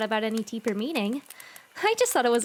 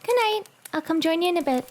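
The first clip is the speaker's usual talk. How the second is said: bright goodnight; 6 semitones higher, 6 dB louder, faster than usual. Acted. Cheerful goodnight call.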